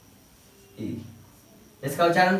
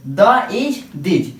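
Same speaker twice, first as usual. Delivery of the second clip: slow, sound by sound: "द इज दिज", each part drawn out, a bit louder; sounded out slowly; 5 dB louder, much slower than usual. Shown as a demonstration. Teacher to students, sounding out "द इज दिज" slowly. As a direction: blend "द इज दिज" very slowly for the class. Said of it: "इसे हम क्या कह सकेंगे?".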